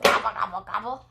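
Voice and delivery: quick gobbles dropping away; turkey gobble. Shown as a demonstration. Teaching a toddler what the turkey says, quick gobbling. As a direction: gobble in a tumbling run of three. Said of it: "The turkey says".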